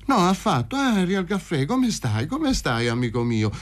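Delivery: high-pitched, eager fussing; effusive greeting. Acted. Paroni asking his friend how he is, high and effusive. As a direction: fuss over him in a high voice.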